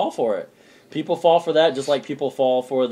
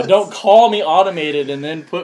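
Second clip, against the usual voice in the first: angry rant; 4 semitones higher, 7 dB louder than usual. Retold or imitated. Ranting at automated phone line.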